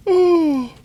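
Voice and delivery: high squeaky giggle, trailing off. Real, not acted. Giggling after sheep launch.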